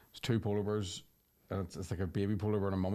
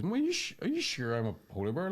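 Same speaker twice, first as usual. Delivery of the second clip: put-on baby polar bear; 7 semitones higher than usual. Baby polar bear asking mummy yet again, high childlike questioning. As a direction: voice a persistent doubting cub, high on "Mummy". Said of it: "the baby polar bear goes".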